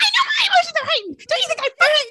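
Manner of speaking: shrieking high and loud, sob-choked fury; a mock tantrum, half crying half screaming; crying shout. Host imagines Elena screaming back, shrill mock crying rage.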